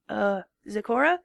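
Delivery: tired, slowing questions; sleepy and worn out; drowsy. A narrator's reading. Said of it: "with a slight drowsiness".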